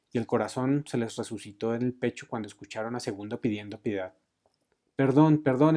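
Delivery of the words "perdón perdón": louder, higher begging cry; shouted plea for pardon. A narrator's reading. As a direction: cry out for forgiveness. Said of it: "empezó a gritar el comandante".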